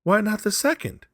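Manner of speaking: high, loud pressing question; feverish. A narrator's reading. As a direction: demand it eagerly. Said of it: "said the old woman feverishly".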